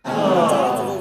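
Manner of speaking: loud call, pitch dropped low; shout. Acted. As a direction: bark her name to stop her. Shouting at teasing relative. (ज़ोर से पुकार कर टोकना)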